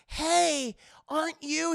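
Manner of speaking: surprised fan calling out; girl voice. Retold or imitated. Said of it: "she's like".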